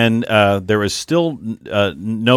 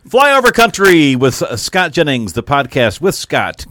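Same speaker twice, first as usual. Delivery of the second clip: podcast intro announcer; 5 semitones higher, 6 dB louder, slower than usual. Acted. Show intro read.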